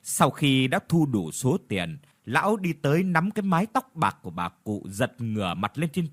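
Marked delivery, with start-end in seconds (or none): none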